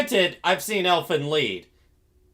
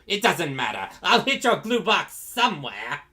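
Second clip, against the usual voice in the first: imitated villain, rising high on the threat; Frieza; 5 semitones higher than usual. Villain dismissing his victim's anatomy question, hammed-up impatient menace. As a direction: brush it off, then threaten in a put-on villain voice. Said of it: "Abridged Frieza".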